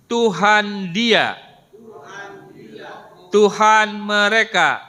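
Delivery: slow, loud, drawn-out words for the class to repeat; slow drill recitation. Shown as a demonstration. Teacher leading the class to read the words together, slow and loud. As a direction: lead the class word by word, slowly and loudly.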